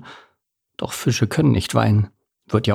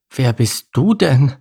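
little salmon asking; 4 semitones higher, 3 dB louder, slower than usual. Acted. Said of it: "fragte Lars".

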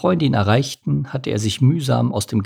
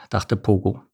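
ordinary delivery, nothing marked

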